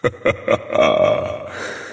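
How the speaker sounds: breathy sinister boss laugh; low evil chuckle. Acted. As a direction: let out a low menacing chuckle.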